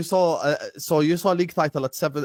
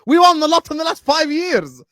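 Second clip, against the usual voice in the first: emphatic boast; 10 semitones higher than usual. Real, not acted. Heated football boast.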